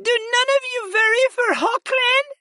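lightly voiced sad princess; mild whimper, high and fast. A narrator's reading. Vala pleading with her sister, a mild high whimper.

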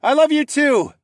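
high, loud answering yell; full shout over a storm. A narrator's reading. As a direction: holler love back across the deck. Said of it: "I yelled".

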